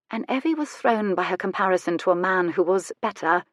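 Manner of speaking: ordinary delivery, nothing marked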